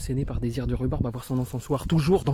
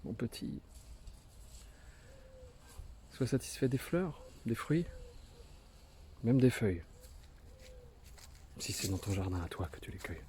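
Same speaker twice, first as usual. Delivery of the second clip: much softer, gentle inner voice; tender self-advice; 12 dB quieter than usual. Acted. The poet telling himself to be content, softly and tenderly. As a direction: hush down as if coaxing a child. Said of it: "Et modeste d'ailleurs se dire".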